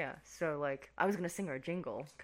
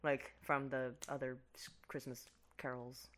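ordinary delivery

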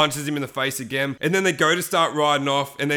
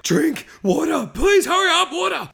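high, hammed-up begging shout; frantic mock cry for water; desperate plea; 14 semitones higher, 4 dB louder than usual. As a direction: beg urgently as if dying of thirst. Acts dehydrated riders bursting into diner.

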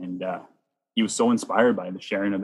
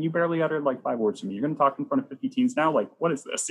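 higher, fast, incredulous inner voice; stunned disbelief; 6 semitones higher, faster than usual. Retold or imitated. His amazed thoughts about Matthew.

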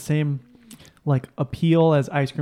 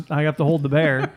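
ordinary delivery

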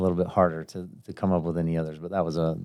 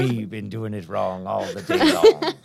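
slow, lightly done pirate voice; slower than usual. Pretend. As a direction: say it like a pirate, slowly. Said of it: "Start talking like a pirate".

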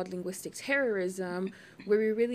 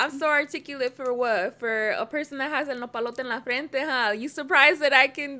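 raised, louder mock-offended comeback; indignant retort; 5 semitones higher, 6 dB louder than usual. Answering a backhanded compliment about being articulate, indignant retort. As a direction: throw the insult back as incredulous questions.

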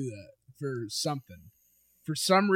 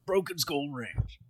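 Stone Cold voice; 3 semitones lower, 8 dB quieter than usual. Retold or imitated. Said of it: "you wanna hear me do Stone Cold".

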